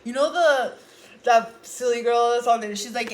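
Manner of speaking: ordinary delivery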